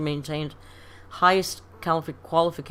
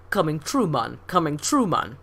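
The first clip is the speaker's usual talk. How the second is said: put-on Jamaican accent. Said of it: "and he would say".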